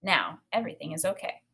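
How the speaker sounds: unvoiced inner thought; whispered thought. A narrator's reading. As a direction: think it to yourself, whispering. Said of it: "I thought".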